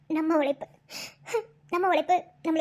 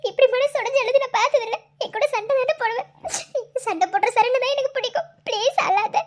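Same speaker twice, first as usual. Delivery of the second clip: tearful pleading; 5 semitones higher, 4 dB louder than usual. Acted. Wife begs crying husband to stop.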